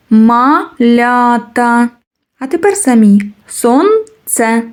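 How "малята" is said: very slow: Ма-ля-та; teacher stretching the word for children; syllable by syllable. Shown as a demonstration. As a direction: sound out "Малята" piece by piece. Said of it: "syllable counting demo".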